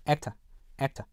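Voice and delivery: British actor ending in "uh", no R; British non-rhotic. Shown as a demonstration. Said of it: "영국 표준 영어에서는요".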